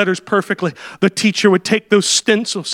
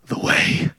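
softly whispered ending; awed whisper; 6 dB quieter than usual. Acted. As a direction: drop to a hush on the way. Tribesman's answer ends whispered.

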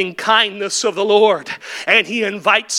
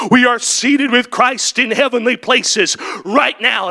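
ordinary delivery

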